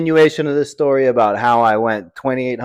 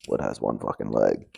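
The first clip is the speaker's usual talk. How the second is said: muttered aside; 9 dB quieter than usual. Real, not acted.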